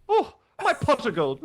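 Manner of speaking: high, slow Irish leprechaun voice. Pretend. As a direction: put on a stage Irish lilt. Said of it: "Is that good?".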